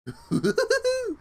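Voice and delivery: high squealing laugh at herself; embarrassed laugh. Real, not acted. Streamer laughing at her failed Tigger, high squeal. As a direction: burst out laughing at the try.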